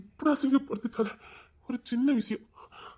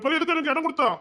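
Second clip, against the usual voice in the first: angry high demand; 8 semitones higher than usual. Acted.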